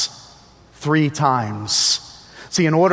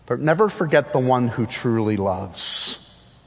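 ordinary talk

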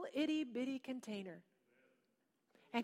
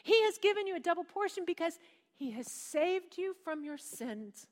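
ordinary delivery

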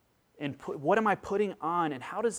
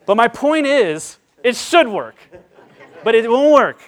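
loud, raised-pitch insistence; emphatic protest; 7 semitones higher, 12 dB louder than usual. Real, not acted. Dad admitting his comfort won't work, loud and emphatic. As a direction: belt it out over the laughter. Insists over the audience's laughter.

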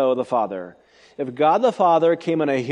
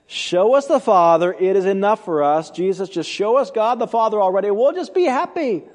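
mock exasperated plea, climbing higher and quicker towards the end; impatient pleading disciple; 4 semitones higher, 3 dB louder than usual. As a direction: mimic a disciple pestering Jesus, louder and rising. Mimics Philip's pleading to Jesus.

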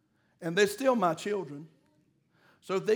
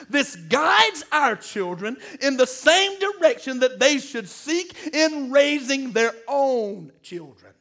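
usual speaking voice

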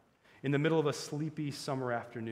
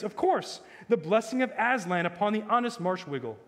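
higher-pitched, rousing prince; excited cry; 8 semitones higher, 6 dB louder than usual. A narrator's reading. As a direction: cry out in joyful relief. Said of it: "cried the prince".

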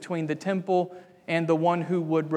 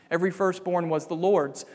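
ordinary talk

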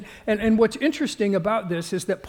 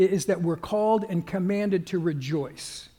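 ordinary delivery

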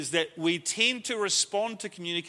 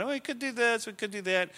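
higher-pitched mock brainstorming; musing aloud; 6 semitones higher than usual. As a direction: lightly act out tossing ideas around. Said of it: "my musings and what I'm thinking about".

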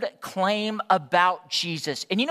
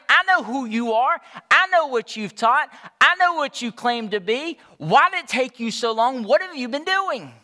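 upset, confused challenge; 5 semitones higher, 6 dB louder than usual. Acted. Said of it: "It's like she's saying".

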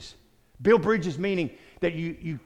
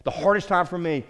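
usual speaking voice

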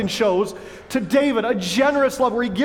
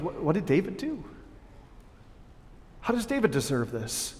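hurt disbelief; 9 dB quieter than usual. Retold or imitated. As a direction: ask it quietly, wounded. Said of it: "Jonathan goes".

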